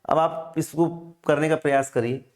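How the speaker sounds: ordinary talk, nothing marked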